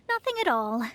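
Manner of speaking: ordinary delivery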